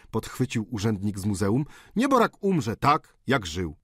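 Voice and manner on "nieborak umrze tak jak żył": high, slow cynical remark; clerk's dry quip. A narrator's reading. Museum clerk quipping to the table, a touch of cynicism. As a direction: drop it coolly.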